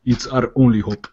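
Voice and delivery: attempted deep African accent; measured African accent, a touch deep. Shown as a demonstration. Voice actor redoing the sci-fi line, deep African accent. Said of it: "the African where it's, like, that, that very deep".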